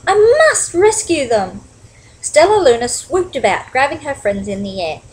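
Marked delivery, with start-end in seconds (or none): 0.1-1.6 high, loud urgent resolve; urgent storybook bat to the rescue; alarmed. A narrator's reading. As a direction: say it with alarm, pitched up. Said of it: "gasped Stellaluna".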